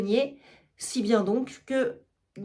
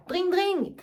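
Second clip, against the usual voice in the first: much higher ringing "dring, dring" like a bell; frantic bell imitation; 8 semitones higher than usual. Argan ringing again in despair, high bell imitation. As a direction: ring the bell one last desperate time. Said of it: "Il sonne une sonnette".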